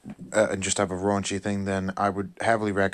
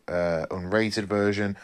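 normal talking voice

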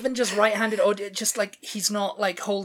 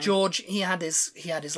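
ordinary talk

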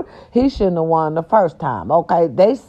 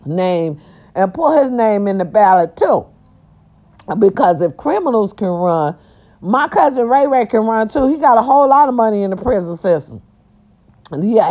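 normal talking voice

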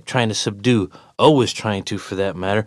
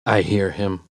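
ordinary delivery, nothing marked

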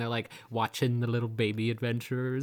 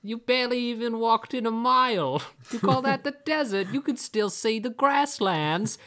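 much higher, louder scoffing voice; mocking companion; 10 semitones higher, 6 dB louder than usual. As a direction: scoff at him loud and high. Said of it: "she's like".

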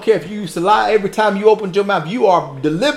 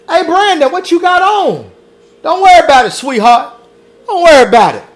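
higher, louder mock flattering crowd voice; fawning crowd; 8 semitones higher, 6 dB louder than usual. Preacher voicing the crowd cheering Jesus on, mock flattering. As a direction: play the gushing admirers, a bit hammed-up.

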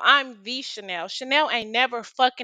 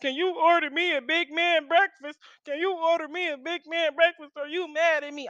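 higher, whiny drunk-guy impression; mock the drunk pestering for food; sloppy drunk guy; 5 semitones higher than usual. Drunk ex pestering her to order him breakfast, whiny drunk-guy voice. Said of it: "His sloppy drunk ass coming in my face".

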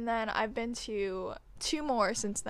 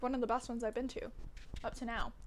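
usual speaking voice

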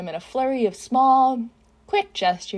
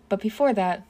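ordinary delivery, nothing marked

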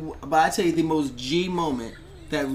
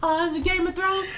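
high, put-on voice; best friend's question; 12 semitones higher than usual. Best friend realizing why there was screaming, high put-on voice. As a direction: pitch it up as the curious friend. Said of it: "She was like".

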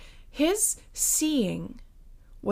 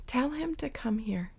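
soft whisper; whispered call; 7 dB quieter than usual. Acted. Jesus inviting the blind man, whispered. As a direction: breathe the invitation.

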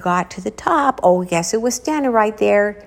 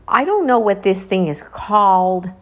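ordinary delivery

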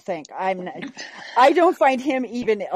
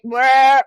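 disgusted bleh; 3 semitones higher, 9 dB louder than usual. Real, not acted. Disgust at actor choice.

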